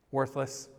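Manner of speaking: ordinary talk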